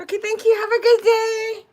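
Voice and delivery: high, loud, over-polite retold farewell; embarrassed hasty goodbye. Embarrassed customer to owner while running out, imitated over-polite goodbye. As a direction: call it out while fleeing. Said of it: "I was so embarrassed".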